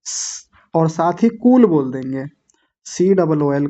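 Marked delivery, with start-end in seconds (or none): 0.0-0.5 light hiss of half स through the teeth. Shown as a demonstration. Teacher building the word in parts, light bare स. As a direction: release a soft स with no vowel. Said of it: "हल्की सी दांतों में से आवाज".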